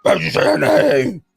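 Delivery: high, loud anguished yell; shouting. Acted. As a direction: cry it out in despair. Movie quote shouted again.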